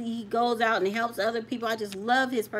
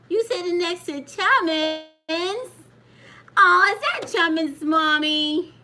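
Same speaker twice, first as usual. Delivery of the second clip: cooing at a pet; 6 semitones higher, 6 dB louder, slower than usual. Real, not acted. Grandma cooing at the dog beside the boy, baby talk. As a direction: coo sweetly at the little dog.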